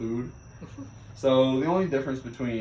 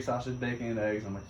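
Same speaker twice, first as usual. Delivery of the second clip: usual speaking voice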